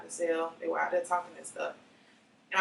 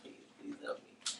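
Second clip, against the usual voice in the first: whispered plea; 15 dB quieter than usual. Retold or imitated. Pleading silently through a window.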